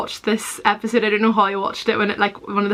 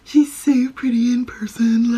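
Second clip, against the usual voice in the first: slow, exaggerated take-off of yourself; teasing parody of how you once talked; mock self-imitation; 3 semitones higher, slower than usual. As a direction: mimic your own awkward delivery, drawn out. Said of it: "why am I talking like that?".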